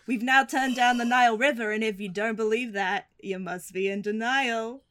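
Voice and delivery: cheesy skipper pun, slowing at the end; hammed-up tour guide joke; theme park ride spiel. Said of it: "original Jungle Cruise ride spiel".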